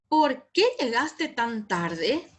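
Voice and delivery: "Por qué llegaste tan tarde" slow, clearly questioning; emphasized question. Shown as a demonstration. Teacher reading example questions, emphatically questioning. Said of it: "hay que enfatizar".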